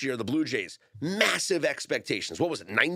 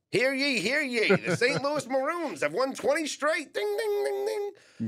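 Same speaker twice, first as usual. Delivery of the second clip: put-on high, booming old-time town crier; 13 semitones higher than usual. Mock 1884 announcement of streak.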